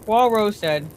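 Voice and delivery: ordinary delivery, nothing marked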